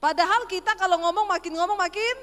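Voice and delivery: high, loud, climbing delivery; rising Sulawesi tone. Shown as a demonstration. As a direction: push pitch and volume upward. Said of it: "orang Sulawesi kalau ngomong selalu nadanya tinggi". (nada makin tinggi ala Sulawesi)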